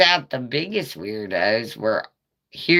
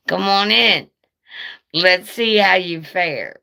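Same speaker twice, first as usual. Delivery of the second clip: high taunting dog voice; dog daring the neighbor to come in, taunting; voicing the dog; 5 semitones higher than usual. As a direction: goad the intruder like a cocky dog, pitched up. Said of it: "Junior was like".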